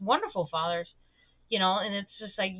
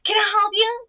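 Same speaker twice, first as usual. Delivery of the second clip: put-on high, louder child-like offer; eager little kid; 10 semitones higher, 6 dB louder than usual. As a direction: imitate a small boy keen to help. Voicing eager small boy offering help.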